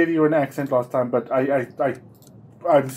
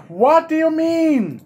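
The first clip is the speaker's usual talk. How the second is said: high, slow disbelief; king aghast at the general; alarmed question; 14 semitones higher, 5 dB louder, slower than usual. Acted. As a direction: stretch it in shock. Demands answer from general.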